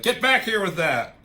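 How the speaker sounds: mock shout. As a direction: call them back with mock authority. Captain America orders others back in retelling.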